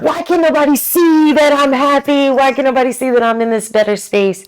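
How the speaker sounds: high, loud plaintive outcry; mock wailing complaint. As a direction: plead to be noticed, hammed up. Acts out her earlier frustrated complaint.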